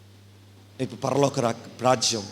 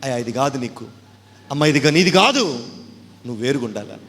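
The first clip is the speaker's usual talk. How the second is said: rising, loud divine rebuke; God warning; 5 semitones higher, 5 dB louder than usual. Acted. God pleads with the sinner, stern and rising. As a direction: warn like God himself.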